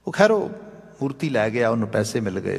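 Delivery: ordinary talk, nothing marked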